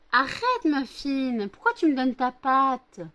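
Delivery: sudden high, loud yelp of protest; startled protest. Real, not acted. Owner protesting as her dog paws at her, high and loud. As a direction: squeal it as the dog paws you.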